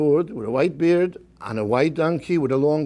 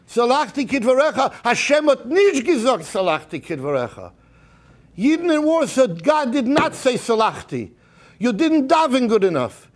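preaching at full force; 9 semitones higher than usual. Acted. Rabbi urging his congregation before Yom Kippur, preaching at full force. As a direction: rouse the congregation, hammering each line.

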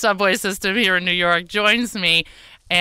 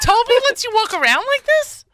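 high mock disbelief; 3 dB louder than usual. Real, not acted. Mock outrage at beard.

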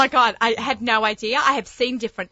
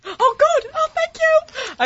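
delighted; 3 dB louder, much slower than usual. Real, not acted. Thanked for bringing sausage.

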